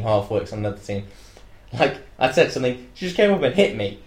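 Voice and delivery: usual speaking voice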